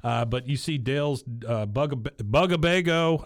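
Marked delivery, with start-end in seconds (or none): 2.2-3.2 "Bugabago" drawn out, higher and louder; "Bugabago" pronounced with triumphant care; careful pronunciation. Real, not acted. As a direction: say "Bugabago" slowly and emphatically, getting it out whole. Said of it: "I said it right".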